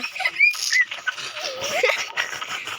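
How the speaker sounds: very high-pitched laughing fit. Real, not acted. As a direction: squeal with laughter at the game. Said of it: "Stop laughing".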